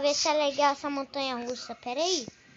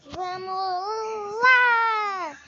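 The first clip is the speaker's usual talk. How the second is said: excited; 2 semitones higher, much slower than usual. Real, not acted. Ride drops.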